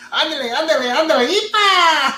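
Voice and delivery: high, laughing cartoon mouse cry; Speedy Gonzalez. Host imitating Speedy Gonzalez for viewers, high laughing cartoon cry. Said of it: "Speedy Gonzalez".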